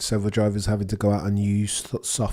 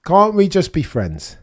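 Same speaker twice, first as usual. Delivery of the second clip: mock plea; 9 semitones higher, 10 dB louder than usual. Pleading chaos in chat.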